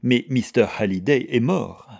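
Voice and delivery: loud, emphatic retort; forceful, exasperated objection; impatient. A narrator's reading. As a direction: exclaim it with impatience. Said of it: "s'écria Diana avec impatience".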